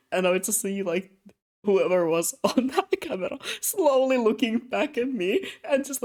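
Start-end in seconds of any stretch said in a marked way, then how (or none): none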